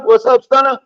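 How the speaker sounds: loud, high call-out to a friend; hyped shout-out. Real, not acted. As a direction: holler it like a radio shout-out.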